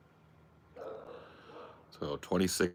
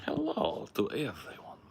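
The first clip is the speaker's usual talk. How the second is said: whisper; 5 semitones higher than usual. Acted. Whispered greeting in pet voice.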